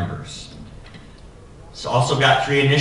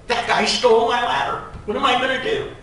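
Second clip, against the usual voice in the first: retold panicked scream; 13 semitones higher, faster than usual. Apprentice hanging from the unit yells to the foreman, high and panicked. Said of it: "Now I'm screaming at the foreman".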